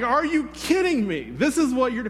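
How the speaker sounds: exasperated and incredulous, acted out; retold indignation. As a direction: replay being mad, pitched well up. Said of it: "So now I'm mad".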